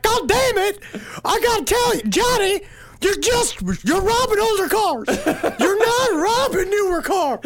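high, exasperated boss scolding; Pee-wee voice. Retold or imitated. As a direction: scold him like a frustrated crook. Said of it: "and P was like".

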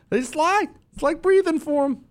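very high, incredulous squeal; scoffing disbelief. Real, not acted. Host dismissing the clip as lies, scoffing. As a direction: let the scorn jump into a very high voice.

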